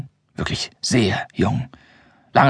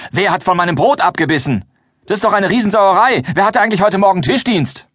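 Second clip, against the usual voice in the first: much higher, louder furious demand; angry shouting; 9 semitones higher, 3 dB louder than usual. Acted. The Venetian demands who had table duty, shouting angrily. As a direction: yell across the table for the culprit. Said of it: "ruft der Venezianer".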